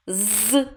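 "з" as a long hard buzz; isolated sound. Shown as a demonstration. Teacher answers which first sound is heard, long buzzed з. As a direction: hold a hard "з" with no clear vowel. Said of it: "Який перший звук чуємо?".

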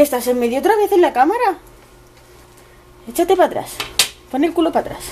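high exasperated scolding of the cat. Real, not acted. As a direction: shoo the cat off, fed up. Telling the cat off.

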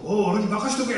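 big-hearted friend boasting loudly; 豪快な友人の声. Retold or imitated. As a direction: play the cocky buddy who says leave it to me. 頼まれて豪快に引き受ける友人. (威勢のいい友人のまね)

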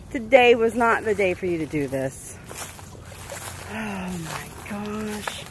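low, quiet, fed-up grumble; irritated. Real, not acted. Owner grumbling at her dog for jumping in. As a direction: let the annoyance sag out under your breath. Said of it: "I'm irritated right now".

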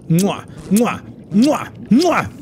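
mock kissing noises. Kissing the caterpillar's eyeball.

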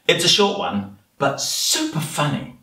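plain reading voice

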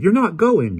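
put-on high, loud protesting girl; girl crying out. Polly begging her father not to leave, high loud cry. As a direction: refuse to let him go. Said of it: "Polly cried".

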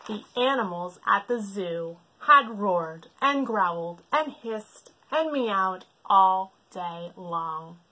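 usual speaking voice

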